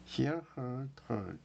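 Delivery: hear as "hee-er", heard as "herd"; demonstrated pronunciation. Shown as a demonstration. Teacher drills verb forms hear, heard, heard, careful pronunciation. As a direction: contrast hear with heard, each word set apart. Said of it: "이렇게 읽어요".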